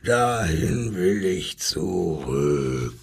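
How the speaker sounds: low, slow lion voice. Acted. Lion tells the zookeeper, low and slow. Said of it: "schrie der Löwe".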